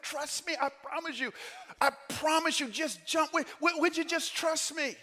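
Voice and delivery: high, insistent acted pleading; pleading father. Dad begging his kids to jump, pleading.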